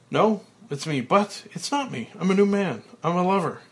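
high, loud joyous declaration; elated. A narrator's reading. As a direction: announce the new man with glee. Transformed man, elated.

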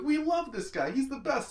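high gushing mock praise; mock adoring fan. Fan voice mocking the town's love for Brody. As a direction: gush about him like a starstruck fan.

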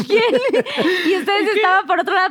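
laughing through the words; chuckling as she talks. Real, not acted. Laughing while retelling the game.

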